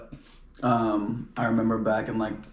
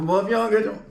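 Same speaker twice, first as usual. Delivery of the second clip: put-on Atlanta accent; 8 semitones higher than usual. Imitating Atlanta people, exaggerated Southern accent. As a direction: mimic an Atlanta local, mock Southern. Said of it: "like, Atlanta people".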